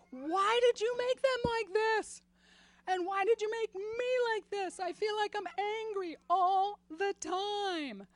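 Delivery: loud, high desperate plea; crying out to God. Retold or imitated. Mother face down on the carpet crying out to God, loud and desperate. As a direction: cry out your anguish to heaven. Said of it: "crying out to God".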